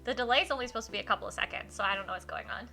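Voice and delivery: usual speaking voice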